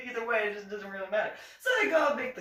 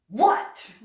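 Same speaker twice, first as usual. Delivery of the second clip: louder clipped snap; sharp what; 4 semitones higher, 9 dB louder than usual. Retold or imitated. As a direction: fire it back at her. Captain snapping back.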